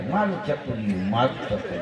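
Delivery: cooing low over a little one; fond, soft coo to a child; lightly mocked doting parent; doting baby talk.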